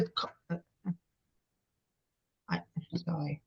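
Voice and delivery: very soft, words failing; real near-tears falter; choked up. Real, not acted. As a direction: break off, overcome, barely voicing it. Choked up telling painful memory.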